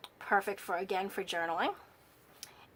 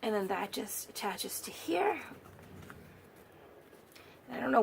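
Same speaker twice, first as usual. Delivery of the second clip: losing her voice; 2 semitones higher, 4 dB quieter than usual. Real, not acted. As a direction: voice fading to a whisper. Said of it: "I feel like I'm losing my voice".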